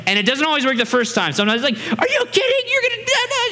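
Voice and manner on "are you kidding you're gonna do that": very high-pitched mock protest; outraged child. Daughter told to ask the right way, mock outrage. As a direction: squeal in disbelief like a thwarted kid.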